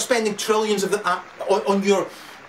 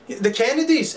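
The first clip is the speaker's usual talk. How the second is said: ordinary talk, nothing marked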